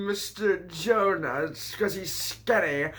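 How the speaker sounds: drawn-out teasing, slowed right down; slow mocking repeat. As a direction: spell it out slowly to mock. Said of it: "Oh, it's 'cause he's skinny".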